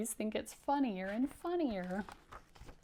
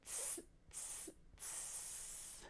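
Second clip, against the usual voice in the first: teasing monkey "tss" hisses; three soft voiceless hisses, last one drawn out; monkey chatter; 22 dB quieter, much slower than usual. A narrator's reading. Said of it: "stamped both their feet back at him and".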